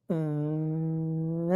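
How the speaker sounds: low humming buzz; imitated motor hum. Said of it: "it goes".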